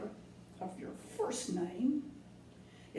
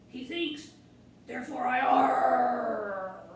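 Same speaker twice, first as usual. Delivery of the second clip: much louder, slow growling arr; punchline arr; 4 semitones higher, 8 dB louder, slower than usual. Acted. Pirate delivering his pun to the kids, slow loud roar. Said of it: "pirate jokes".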